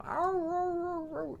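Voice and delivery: very high yowling howl; high dog ow-row howl. Acted. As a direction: howl like a sad shaved puppy. Dog howls at shampoo.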